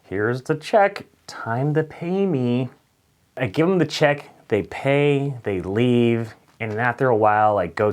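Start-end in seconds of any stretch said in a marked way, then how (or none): 0.0-2.7 slow, pointed and higher; pushy server. Retold or imitated. Waiter handing Jamie's table the check, slow and pointed. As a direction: drag it out, hinting they should pay. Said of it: "in my head I'm like".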